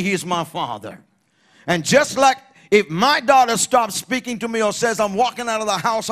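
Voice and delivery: high, loud, impassioned preaching; preaching at full force. Real, not acted. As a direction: preach with rising fervour to the congregation.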